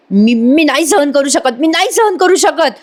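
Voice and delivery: high-pitched mock yell, climbing on the repeat; retold outburst. Priya unable to bear their happiness, high mock yell. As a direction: act out a tantrum without real volume. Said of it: "असं म्हणून ती मोठ्याने ओरडते".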